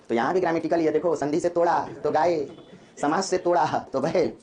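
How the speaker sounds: ordinary talk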